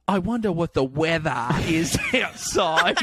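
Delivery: slow, overdone British sliding Australian; British-Australian accent. Retold or imitated. American failing British test for friend, comic Australian slip. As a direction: ham up a wobbling Australian-British accent, laughing at the end. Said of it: "you just went full Australian".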